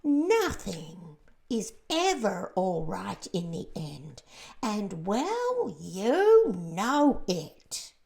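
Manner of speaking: loud, very slow doomy insistence; gloomy earthworm. A narrator's reading. The earthworm insists nothing ends well, bitter.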